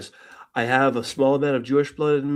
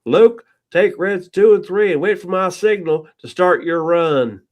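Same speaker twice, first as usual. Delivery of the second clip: a Brit putting on a lightly louder John Wayne American drawl; a hint of John Wayne, pitched up; 6 semitones higher, 5 dB louder, slower than usual. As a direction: play Red Leader faking a cowboy American accent. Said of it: "I'm gonna sound like John Wayne".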